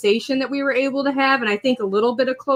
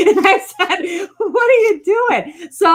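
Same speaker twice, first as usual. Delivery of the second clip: laughing through the words, slow; 8 semitones higher, slower than usual. Real, not acted. Mother laughs retelling the friend's story, slow and high. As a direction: crack up while asking. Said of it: "laughs while retelling".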